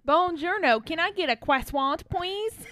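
loud, high, hammed-up French tourist accent; mock Parisian accent. Host play-ordering in Paris, mock French accent. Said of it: "British accents and our Parisian accents".

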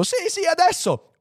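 very high eager yes; impatient insistence. Acted. (insistenza acuta e impaziente)

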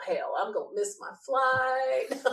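slow, drawn-out comic wail; mock dread. Retold dread at being taken aside.

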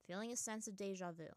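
ordinary delivery

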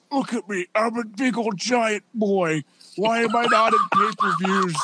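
mock pouting giant, ending in a whisper; whiny giant. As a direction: complain like an overgrown kid. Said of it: "be like".

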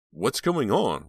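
very worried. A narrator's reading. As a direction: ask it with sudden worry. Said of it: "very worried".

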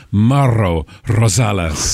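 Mauro Rosales slow, R's trilled; imagining the player saying his own name; rolled R's. Retold or imitated. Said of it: "like to think of him saying".

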